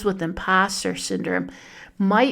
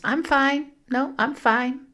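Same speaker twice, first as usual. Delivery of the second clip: higher-pitched, mildly defensive protest; defensive insistence; 6 semitones higher than usual. Acted. Iron Woman insisting to others she is fine, mildly defensive. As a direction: brush off concern with a high, defensive reply. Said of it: "their defensive nature is".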